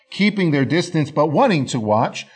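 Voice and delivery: plain reading voice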